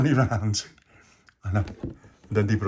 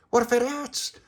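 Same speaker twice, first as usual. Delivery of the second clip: indignant protest; 13 semitones higher than usual. Acted. Tony protests the warning.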